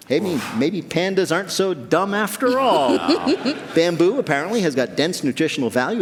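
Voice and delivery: ordinary delivery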